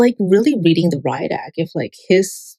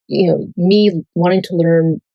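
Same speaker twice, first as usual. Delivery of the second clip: ordinary talk, nothing marked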